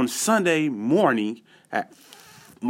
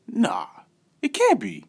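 disbelief; slower than usual. Retold or imitated. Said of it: "I'm squinting like".

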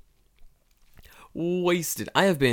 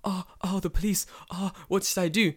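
mock panic; 6 semitones higher than usual. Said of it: "My Japanese friend was freaking out".

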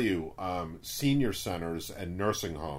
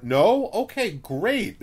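higher, pleased salesman; salesman pitch; 10 semitones higher, 5 dB louder, much slower than usual. Pretend. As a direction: fake delight at the answer. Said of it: "you gotta go in and say".

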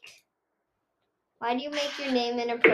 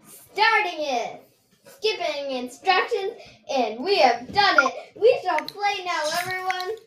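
loud excited game announcer; excited announcing; 3 semitones higher, 4 dB louder than usual. Real, not acted. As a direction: announce the start with gusto.

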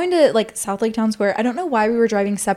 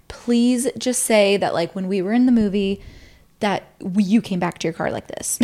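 pleading whisper. Retold or imitated. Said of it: "she's like".

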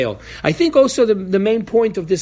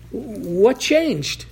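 usual speaking voice

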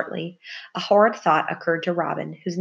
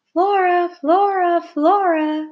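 high, loud little voice calling a name; excited squeaky echo of a call; high-pitched echo; 12 semitones higher, 6 dB louder, slower than usual. Acted. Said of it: "the echo was more high-pitched".

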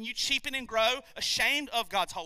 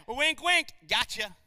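mock winking God; 7 semitones higher, 4 dB louder than usual. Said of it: "and say".

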